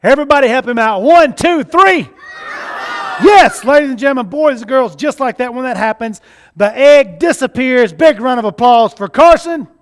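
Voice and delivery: loud, showy magician announcing; kids party magician. Acted. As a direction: build up the reveal for the crowd. Magician counts and reveals to crowd.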